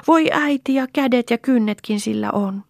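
put-on very high little-girl exclamation; high, loud, thrilled child voice; child's excited shout. A narrator's reading. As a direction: cry out each discovery to mother. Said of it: "huusi Lea".

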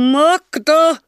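very loud, very high bellow; terrible roar. A narrator's reading. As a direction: roar her name like a mob. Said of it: "hirmuisena karjuntana".

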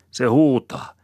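high, loud tirade; angry scolding. A narrator's reading. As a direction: quarrelsome wife railing back. Woman scolds back in quarrel.

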